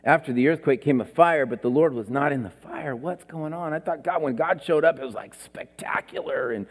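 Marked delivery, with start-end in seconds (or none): 5.0-6.7 hushed, breathy wonder; awed whisper. Retold or imitated. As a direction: whisper it like a secret marvel. Mock awe at God's arrival.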